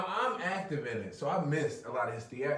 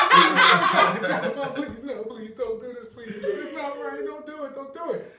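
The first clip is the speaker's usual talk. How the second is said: high wailing plea broken by laughter; mock tearful begging; 11 semitones higher, 10 dB louder than usual. Son begging dad upstairs, mock tearful pleading through laughter. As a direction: imitate a whimpering kid while cracking up.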